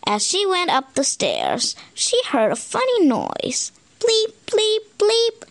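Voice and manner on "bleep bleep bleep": high electronic bleep sounds; computer bleeps. Acted. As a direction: bleep like a game console. Computer noise in story.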